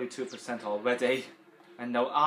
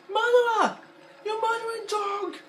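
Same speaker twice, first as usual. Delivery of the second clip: high screaming accusation; furious accusation; 10 dB louder, slower than usual. Retold or imitated. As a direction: scream it at the murderer. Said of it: "he was like".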